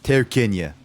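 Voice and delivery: put-on slow slurred drunk guy; lightly slurred drunk question. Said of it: "in the drunk voice kind of thing".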